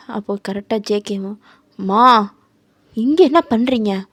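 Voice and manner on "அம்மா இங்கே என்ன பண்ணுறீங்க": much louder, calling out to his mother. Acted. JK spots his mother with the girl and calls out loud. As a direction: call out to mum, surprised.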